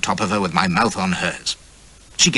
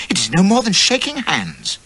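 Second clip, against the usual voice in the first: indignant insistence; 12 semitones higher, 3 dB louder than usual. A narrator's reading.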